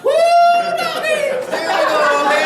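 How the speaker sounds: a very high, loud cowboy yell; rodeo whoop. Acted. Riding the giant scorpion like a bucking bronco, loud rodeo whoop. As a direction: whoop like a bronco rider hanging on.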